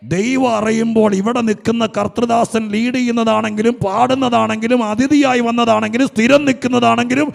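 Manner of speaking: loud, high, rising preaching shout; preaching at full force. Acted. Preacher shouting the promise to the weeping congregation. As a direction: thunder it out to the whole church.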